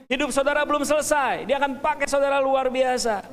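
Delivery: loud rising preacher's proclamation; rally the congregation, full voice; fervent preaching. Real, not acted. Pastor proclaims to the congregation, booming.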